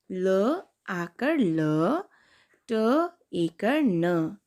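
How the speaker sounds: very slow letter by letter; slow spelling. Shown as a demonstration. Teacher repeating the spelling for kids. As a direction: spell it out slowly again. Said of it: "अब दूसरा शब्द है".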